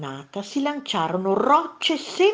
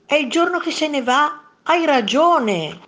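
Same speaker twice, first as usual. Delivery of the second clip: high, loud wonder, slowing down; marvel, then gladly concede; delighted realisation; 8 semitones higher, 7 dB louder than usual. A narrator's reading. Second monster concedes in wonder. (meravigliato, dà ragione)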